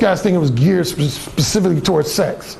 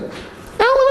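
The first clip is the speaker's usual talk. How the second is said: gentle rising "Alan"; imitating her probing tone; high woman's voice.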